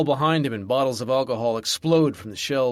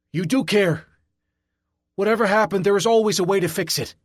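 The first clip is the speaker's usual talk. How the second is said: much higher and louder, insistent; urgent security guard; 9 semitones higher, 6 dB louder than usual. A narrator's reading. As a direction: urge him hard not to give up. Guard voice urging.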